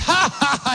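high-pitched acted belly laugh; hearty mock ha-ha-ha; imitated laughter. Preacher imitating his amused host, put-on laughing. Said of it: "And he's laughing".

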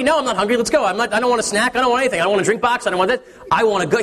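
high, rushed string of no's; impatient kid. Retold or imitated. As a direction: wave everything off like an eager child. Son refuses everything, eager.

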